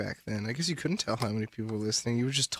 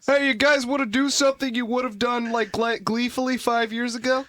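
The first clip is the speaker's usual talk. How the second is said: put-on awkward teenager; 9 semitones higher, 3 dB louder than usual. Mocking awkward teen invitation.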